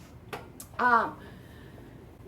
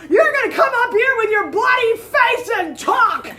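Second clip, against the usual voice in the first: much higher and louder, laughing through it; cracking up while ranting; laughing mock rant; 11 semitones higher, 13 dB louder than usual.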